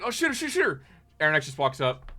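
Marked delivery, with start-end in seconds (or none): 0.0-0.8 high, rapid urging; raised, pitched-up mock clamour; mock group clamour. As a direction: imitate an excited crowd egging someone on. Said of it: "everybody's like".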